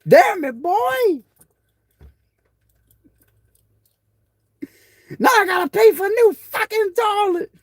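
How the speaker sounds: very high mock mama complaint; imitating mad mama. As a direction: gripe like a fed-up mother. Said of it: "it was funny when she get mad".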